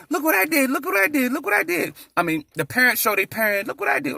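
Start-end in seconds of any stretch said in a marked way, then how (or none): 0.1-1.9 put-on eager child voice. Child voiced showing drawing. 3.7-4.2 put-on eager child voice. Child voiced showing drawing.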